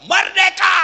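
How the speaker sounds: loud, high cry of freedom; mock husband shouting for joy; joyful shout. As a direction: yell it like a victory cheer. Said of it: "suami masuk kamar berteriak".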